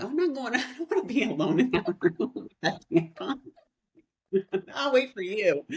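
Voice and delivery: laughing through a scared refusal; laughing mock fear. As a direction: giggle the words out, half joking about being spooked.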